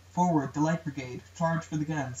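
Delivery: ordinary talk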